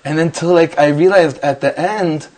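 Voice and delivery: ordinary talk, nothing marked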